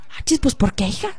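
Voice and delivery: low, hushed, offhand reply; quiet casual friend. Retold or imitated. Juanita shrugging off the missed delivery, low and hushed. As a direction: play it down, shrug it off softly.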